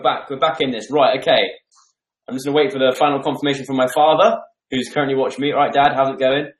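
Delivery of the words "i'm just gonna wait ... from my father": ordinary talk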